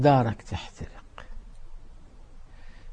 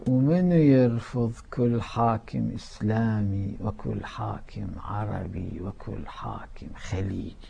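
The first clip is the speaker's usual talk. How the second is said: ordinary delivery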